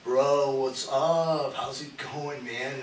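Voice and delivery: slow, drawn-out laid-back greeting; lazy, dragged-out dude voice; Ben Cato. Retold or imitated. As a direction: imitate him stretching out every word. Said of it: "he talks like this".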